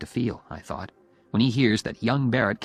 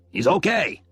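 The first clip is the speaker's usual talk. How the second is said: high, loud, emphatic verdict; hearty approval; 7 semitones higher, 6 dB louder, slower than usual. Acted. Phil approving his daughter's boyfriend, hearty. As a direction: announce your approval with gusto.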